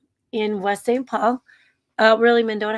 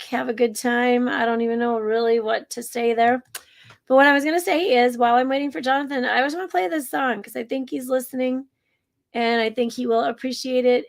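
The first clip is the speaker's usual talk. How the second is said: usual speaking voice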